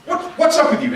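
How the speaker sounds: loud, high, accusing voice, lightly acted; mock indignant scolding; scolding outburst. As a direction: tell him off as the upset sister. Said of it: "She's just letting him have it".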